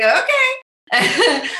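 high laughing agreement; laughing okay. Retold or imitated. Answering her husband's support, laughing okay. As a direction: chuckle out a delighted okay. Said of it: "I'm like".

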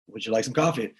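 low, quiet, unbothered waiter offer; low routine offer. Retold or imitated. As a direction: ask again as if nothing happened. Waiter back at first table.

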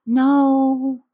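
put-on high, small child's "No"; little boy voice. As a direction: answer like a five-year-old. Son answers in child voice.

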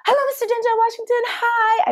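imitating a starstruck fan; starstruck hello. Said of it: "And I go".

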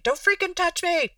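playful high-pitched don't-touch-me outburst; angry refusal. Acted. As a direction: snap it out like an upset partner pulling away.

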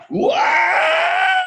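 very high, drawn-out cry; sudden scream. Retold or imitated. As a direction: let a scream burst out from the gut. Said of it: "I screamed".

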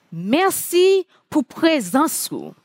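slow, deliberate Haitian Creole greeting; a slow, heartfelt thank-you in Haitian Creole. Real, not acted. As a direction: say it slowly in Haitian Creole, letting each word land. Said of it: "I would say to you in Haitian Creole".

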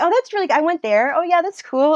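high, bright show of interest; light up for the kids; keen interest. Retold or imitated. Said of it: "I was just really interested".

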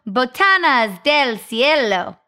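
slightly Italian-tinged Spanish title; Italian-sounding Spanish. Acted. Host announcing the telenovela's title, Italian-sounding Spanish. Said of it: "Sound a little Italian".

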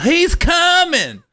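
high, slow drawn-out call of alarm; yelled warning. Retold or imitated. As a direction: call out across the trailer park. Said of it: "three people yelled".

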